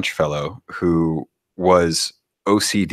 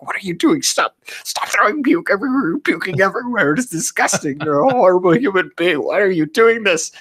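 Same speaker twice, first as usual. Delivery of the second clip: much higher, ranting mock French scold; angry yelling; 12 semitones higher than usual. French crewmate yelling at drunk player over puke. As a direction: scold the drunk like a furious neat freak. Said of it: "just yelling at him".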